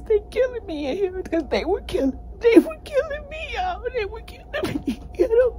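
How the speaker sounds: high, breaking sobs; sobbing out the words; crying. Real, not acted. Recounting near death in isolation.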